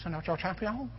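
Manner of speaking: high, soft cartoon French accent; son's mock French accent. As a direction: imitate a little boy copying a French cartoon taunt. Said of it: "he'll come in and go".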